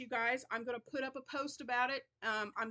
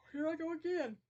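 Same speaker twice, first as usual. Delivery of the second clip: yawning; 7 semitones higher than usual. Real, not acted. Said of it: "so I don't know why I'm yawning".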